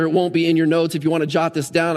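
ordinary delivery

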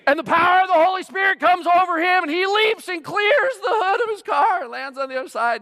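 high, shouted play of a miracle; hammed-up, thunderous stunt narration; mock-epic climax. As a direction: build it like a triumphant revival climax.

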